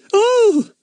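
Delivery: high, loud gasp of delight. A narrator's reading. Bets thrilled by the inspector's invitation, delighted. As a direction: squeal with joy.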